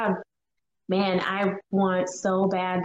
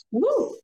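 high excited whoop; 14 semitones higher than usual. Real, not acted. Celebrating after thanking friends, high whoop. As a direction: let out a high cheer. Cheering exclamation.

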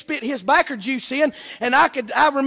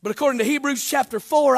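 ordinary talk, nothing marked

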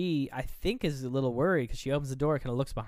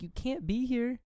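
much higher imitated protest; worried girl, retold; 12 semitones higher, slower than usual. Retelling a girl warning off her boyfriend, high mock protest. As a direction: pitch it up like a girl in a mock panic.

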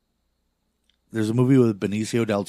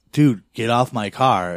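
louder and slow, a retold warning shout; slow, louder mock yell at the guys on the car; 3 semitones higher, 7 dB louder, slower than usual. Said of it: "the guy is like".

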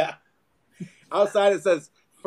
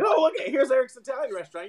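higher, bemused tourist voice; mock confused customer; 9 semitones higher than usual. Customer pulling into the fake Italian place, mock bewildered. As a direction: sound lost and puzzled.

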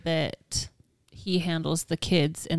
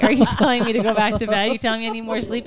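indignant; 4 semitones higher, 7 dB louder than usual. Retold or imitated. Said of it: "And I was like".

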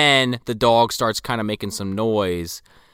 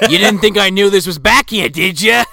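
mock gruff Sarge; 6 semitones higher, 5 dB louder than usual. Voicing Sarge catching him.

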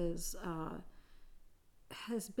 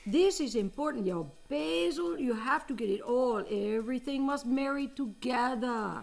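put-on Italian accent; 4 semitones higher than usual. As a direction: preach about sauce like an excitable Italian cook. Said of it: "game of accent improv".

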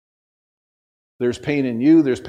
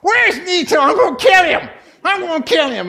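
comic high-pitched shouting impression; hollered threat; 5 dB louder than usual. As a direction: imitate a furious man bellowing across a warehouse. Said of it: "I hear him going".

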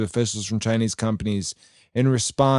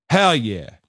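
emphatic cheer. Real, not acted. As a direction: punch it out like a fist pump. Cheering Marsha's letter, louder repeat.

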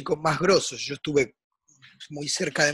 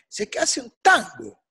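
ordinary talk, nothing marked

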